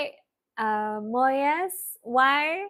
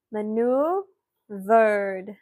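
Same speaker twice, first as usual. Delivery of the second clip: MANEUVERED drawn out very slowly, in separated parts; slow breakdown; 3 semitones lower, much slower than usual. Shown as a demonstration. Teacher breaking the word down for spellers, very slowly. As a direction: stretch out each part of "Maneuvered".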